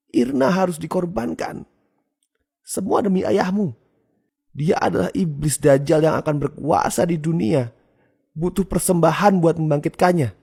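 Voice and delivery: high-pitched voice of a zealot; fanatical mother. A narrator's reading. Mother tells child why Irna must die, fanatically.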